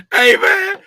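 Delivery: laughing. Real, not acted. After laughing.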